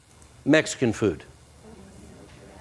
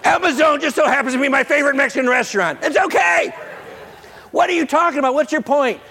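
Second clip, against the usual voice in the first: laughing protest; 12 semitones higher, 4 dB louder, faster than usual. Real, not acted. Reacting to wife's reveal.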